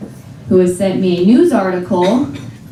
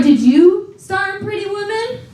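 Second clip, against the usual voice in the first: high sing-song sarcasm; sarcastic jab; 10 semitones higher than usual. Retold or imitated.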